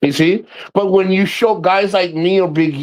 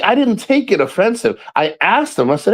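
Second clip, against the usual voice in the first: ordinary talk, nothing marked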